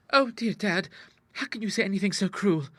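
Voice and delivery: put-on high-pitched, wounded girlish reproach; pleading cry. A narrator's reading. Daughter burrows against father, crying out in reproach. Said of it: "cried Ida".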